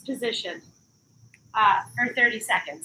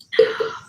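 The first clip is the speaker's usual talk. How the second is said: heavy breath out; loud tired sigh; 5 dB louder than usual. Real, not acted. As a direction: blow out a long breath. Resting after the set.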